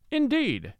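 high-pitched astonished exclamation; sharply surprised cry. A narrator's reading. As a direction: exclaim it in amazement, pitch leaping up. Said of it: "cried the astonished doctor".

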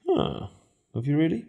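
high, softened questioning lilt; mock scepticism. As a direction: doubt it in a high little voice. Mimes doubting a rider's covid claim.

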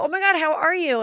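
light up at seeing him; excited greeting. Retold or imitated. Host greets old friend, high and excited.

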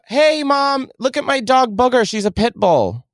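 put-on high, eager kid showing off a dog; excited kid voice. Shown as a demonstration. Showing mom the dog Booger, high excited kid voice. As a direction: call to mom like a thrilled child. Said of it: "Say it again".

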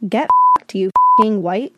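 mock yell. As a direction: belt it out like the angry guest. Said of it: "reading insult aloud".

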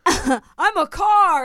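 very slow, high "I'm a car", savoured; mock proud claim. Host repeating the guest's blunder, slow and high mock boast. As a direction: mimic the dumb boast with relish.

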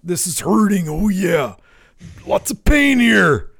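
high, strained wrestler impression, slowing at the end; put-on wrestler voice, playing winded and hurting; Macho Man. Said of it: "as if he's out of breath".

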